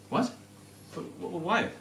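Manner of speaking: soft, stammering mock bafflement; stunned disbelief. Hearing the landlord refused, hushed stammering disbelief. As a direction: trail off, lost for words.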